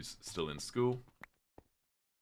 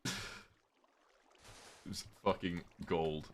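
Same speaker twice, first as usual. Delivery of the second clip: ordinary talk, nothing marked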